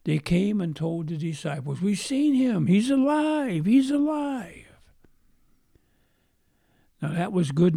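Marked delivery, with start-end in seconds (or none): 1.8-4.8 high, louder joyful cry; excited news. Retold or imitated. Women telling the disciples he's alive, high and excited. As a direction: burst out with the good news. Women announce Jesus resurrected.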